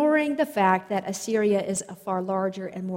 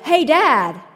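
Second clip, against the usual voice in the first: higher, louder Hey, Dad; retold yell; 6 semitones higher, 9 dB louder than usual. Son yelling to his father while jumping, retold yell. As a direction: call out Dad from mid-air. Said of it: "then yelled".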